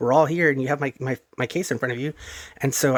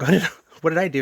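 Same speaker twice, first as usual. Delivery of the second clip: alarmed; 5 semitones higher, 4 dB louder, faster than usual. Retold or imitated. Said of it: "I'm like".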